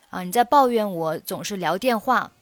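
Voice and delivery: normal talking voice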